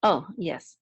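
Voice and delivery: usual speaking voice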